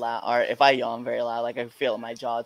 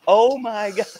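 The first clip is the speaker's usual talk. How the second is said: shocked; 9 semitones higher, 8 dB louder than usual. Retold or imitated. Friend reacting, retold.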